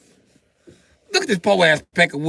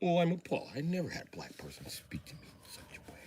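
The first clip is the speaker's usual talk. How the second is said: hushed, scandalized whisper; mock-offended whispered gasp; appalled fisherman; 2 semitones lower, 17 dB quieter than usual. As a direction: clutch your pearls in a whisper.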